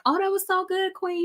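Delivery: high, gushing caller voice; imitating gushing callers. Daughter acting out friends phoning her mom after the show, gushing imitation. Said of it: "getting phone calls and texts like".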